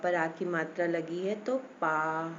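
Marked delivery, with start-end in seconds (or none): 1.8-2.3 पा held long with a wide-open "aa"; long open vowel. Shown as a demonstration. Teacher showing the aa matra, drawn-out open vowel. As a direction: open right up on पा. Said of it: "पूरा मुंह खुल गया ना".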